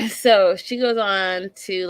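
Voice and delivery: normal talking voice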